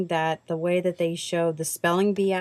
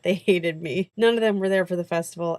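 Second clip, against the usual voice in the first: ordinary talk, nothing marked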